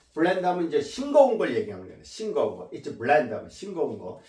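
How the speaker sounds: ordinary talk, nothing marked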